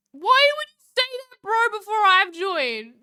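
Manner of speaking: high, loud outraged disbelief; indignant surprise. Real, not acted. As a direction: protest in shocked disbelief. Someone said no one joined.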